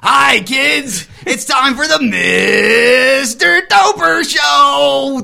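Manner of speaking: big booming welcome, slow and high; kids' show host shout. Acted. Puppet greets the kids at his show, loud high host shout. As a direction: announce the show to the kids at full blast.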